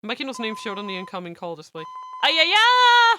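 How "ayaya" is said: high, loud drawn-out "ayaya" squeal; excited squeal. Real, not acted. Streamer seeing who is calling, excited squeal. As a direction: squeal it out at full volume. Outburst at the caller's name.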